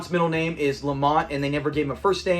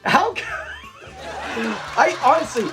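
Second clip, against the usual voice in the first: laughing; 10 semitones higher than usual. Real, not acted. Laughing mid-sentence.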